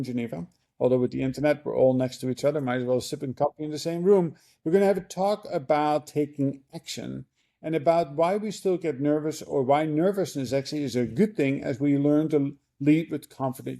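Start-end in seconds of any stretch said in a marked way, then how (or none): none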